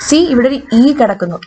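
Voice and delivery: ordinary delivery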